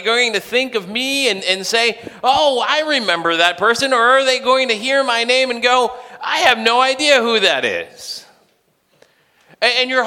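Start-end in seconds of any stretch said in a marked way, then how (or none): 2.2-3.9 high, louder acted recognition; pleased recognition. Professor recalling a student after graduation, pleased recognition. 6.2-8.3 high, louder acted bafflement; blank non-recognition. Professor hearing a former student's name, baffled.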